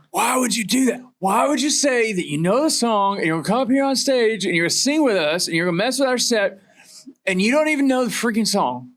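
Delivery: high-pitched mock-angry rant; Dave. Bandmate scolding a singer who botched the song, mock outrage. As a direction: imitate an exasperated bandmate scolding, voice pushed up high. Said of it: "Dave gets so mad".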